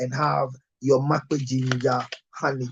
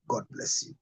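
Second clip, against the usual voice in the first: whispered blessing; 11 dB quieter than usual. Shown as a demonstration. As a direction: whisper "God bless you" softly, voice dropped to a breath. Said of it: "I repeat".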